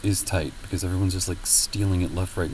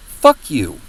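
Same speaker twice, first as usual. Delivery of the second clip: loud, blunt "Fuck you"; mock angry snap; 5 semitones higher, 10 dB louder than usual. Strangers dismissing someone's attempt to talk, loud curse. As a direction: spit it back like a rude stranger. Rude person's retort acted out.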